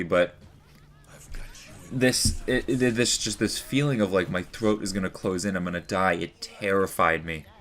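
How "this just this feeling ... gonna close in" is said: usual speaking voice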